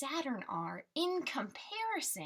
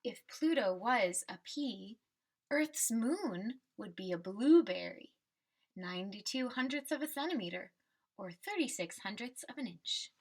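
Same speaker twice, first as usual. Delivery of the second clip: put-on reading to children. Teacher reading the class a picture book, storybook voice. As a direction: read the refrain like a picture book for kids.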